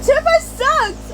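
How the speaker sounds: very high, loud, slow scream; furious drawn-out scream. A narrator's reading. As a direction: scream it back with all you have. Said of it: "I screamed back".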